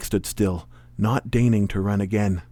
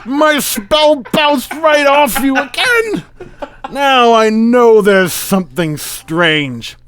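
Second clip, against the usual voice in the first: loud, high, slow melodramatic cry; exclaiming; 14 semitones higher, 10 dB louder, much slower than usual. Acted. Magician whose spell bounced off his rival, exclaiming. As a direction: declaim it at full theatrical pitch. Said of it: "Trent exclaimed".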